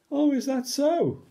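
high sing-song surprise; surprised. Acted. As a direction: put on a playful astonishment. Reader's mock surprise.

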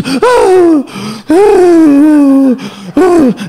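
loud, long eerie cries; imitated possessed wail. Comedian imitating his friend being possessed, loud eerie wailing. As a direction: wail loudly as if a demon takes over.

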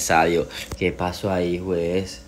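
normal talking voice

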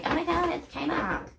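high mock gushing; mock excitement. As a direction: gush over a cheap store. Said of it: "we all like".

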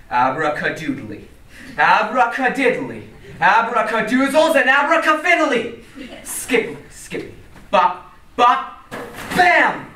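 magic words building to a shout; kids party magician. Acted. Priest performs magic trick incantation.

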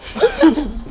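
short amused laugh. Real, not acted. Laughing at her own impression of the liar. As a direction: break into a laugh.

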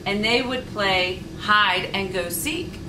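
ordinary delivery, nothing marked

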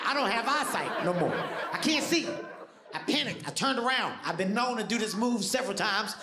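normal talking voice

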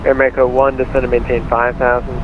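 normal talking voice

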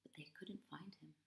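soft, quick whisper. A narrator's reading. Narrator whispering that the search failed. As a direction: let it fall to a whisper.

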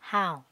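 teacher model of how; model word how. Shown as a demonstration. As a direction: give How a clear "ow" vowel. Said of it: "Từ how".

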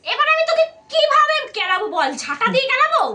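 angry threat. Acted. Grandma threatens with broom.

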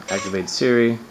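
ordinary talk

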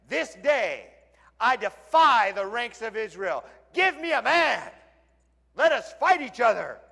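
loud defiant challenge; Goliath's challenge. Retold or imitated. Goliath defying the ranks of Israel, loud challenge. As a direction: dare the whole army.

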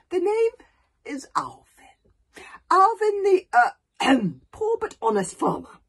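high, loud, theatrical flourish; grand self-introduction. A narrator's reading. Alvin introducing himself after a graceful bow, grandly. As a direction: announce yourself with a showman's flair.

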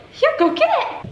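very high, encouraging squeal. Real, not acted. Mom sending toddler to fetch, high playful voice.

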